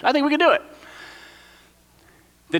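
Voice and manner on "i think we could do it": high, light hesitant voice; timid Caleb. Pretend. Preacher repeating Caleb's hopeful line to the congregation, in a lighter higher voice. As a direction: offer it shyly up high. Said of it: "that's Caleb's role in the passage".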